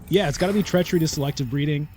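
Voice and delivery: ordinary delivery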